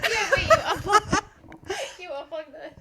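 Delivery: words breaking up with real laughter; laughing. Real, not acted. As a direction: laugh through it as you notice the cord. Mic unplugged mid-recording.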